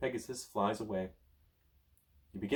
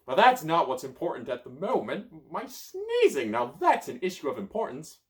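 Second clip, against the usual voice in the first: high, loud, fussy; grouchy wizard; 7 semitones higher, 6 dB louder than usual. Acted. Merlin complaining about sneezing, high and loud. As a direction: make the sneezing sound grave.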